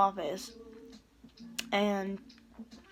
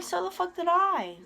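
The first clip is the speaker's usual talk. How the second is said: higher, louder, defiant comeback; indignant retort; 7 semitones higher, 4 dB louder than usual. Retold or imitated. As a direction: snap back at the counselor. Retold comeback to the counselor.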